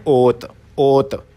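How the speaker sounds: ओत said slowly with a clear round o; slow Korean demo. Shown as a demonstration. Teacher models the Korean word for students, slow and careful. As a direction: stretch out ओत, rounded o, not oo. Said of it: "कपड़े को Korean में क्या कहते हैं?".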